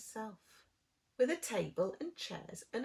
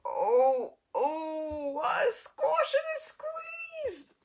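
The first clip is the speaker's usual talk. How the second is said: very high, slow crying shout; squashed creature crying out; 6 semitones higher, 4 dB louder, much slower than usual. Acted. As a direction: wail as if squeezed tight. Squashed animal cries out.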